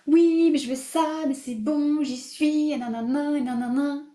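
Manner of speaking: high-pitched sing-song blather of making excuses; mock self-justifying. Coach mimicking her old self-bullshitting, mock self-justifying.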